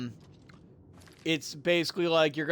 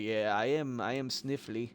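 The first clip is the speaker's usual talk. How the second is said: lower, softer, stuffed-up mimicry; mock sniffly guy; 6 semitones lower, 7 dB quieter than usual. Mocking the sniffly guy in the waiting room, low and soft. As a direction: play the sick dude, low and limp. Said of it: "He's like".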